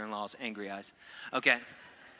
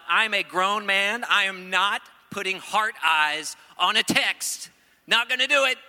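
stubborn refusal; 6 semitones higher, 10 dB louder than usual. Retold or imitated. Stubborn younger self refusing emojis.

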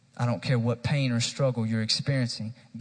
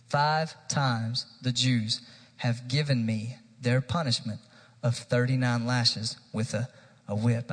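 focused rereading. Real, not acted. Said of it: "I want us to focus on this".

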